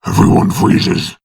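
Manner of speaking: ordinary talk, nothing marked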